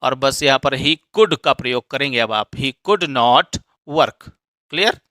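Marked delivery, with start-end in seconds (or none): 2.5-4.3 very slow, word by word; deliberate classroom reading pace; slow dictation. Shown as a demonstration. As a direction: spell it out slowly for students. Teacher reads example sentence.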